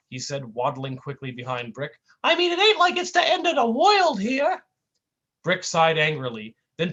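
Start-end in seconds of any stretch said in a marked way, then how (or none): 2.2-4.6 high goofy cartoon whine. Sidekick whining at his mean partner, high goofy voice. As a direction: plead like a hurt buffoon.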